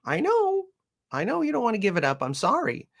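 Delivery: high, soothing coo; soothing the puppy. Retold or imitated. Owner soothing her growling puppy, high gentle coo. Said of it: "And I'm like".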